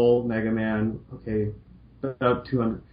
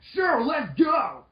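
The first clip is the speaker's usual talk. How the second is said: excited surfer shout; slower than usual. Acted. Surfer-voiced Mega Man shouting to Roll, stoked. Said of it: "the surfer voice".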